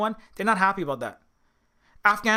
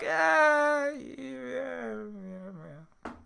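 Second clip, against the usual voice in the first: higher pitched, lightly voiced mock Brit shrugging it off; a half-hearted imitation of an indifferent Britain, higher; 5 semitones higher, 5 dB quieter, much slower than usual. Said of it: "Britain's kinda like".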